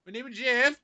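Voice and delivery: retelling the scene to the cashier, hammed-up; acting out the meme. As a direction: ham up the famous meme line. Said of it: "acted it out".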